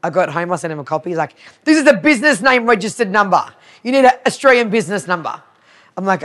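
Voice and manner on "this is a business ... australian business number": fast, raised-pitch insistent explaining; mock impatient official, louder and quicker; exasperated correction. Retelling a man correcting her business details, exasperated.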